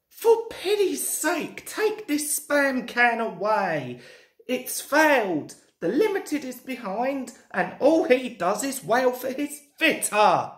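high-pitched, exasperated complaint; fed-up signalman's urgent grumble. Acted. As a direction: demand it in sheer impatience. Signalman complains about broken-down diesel.

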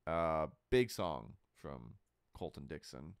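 ordinary talk

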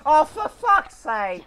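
loud, slow, high exasperated shout; furious yell. Real, not acted. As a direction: drag it out in fury. Hit again by car.

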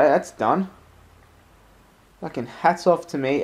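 usual speaking voice

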